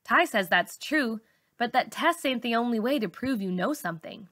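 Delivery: plain reading voice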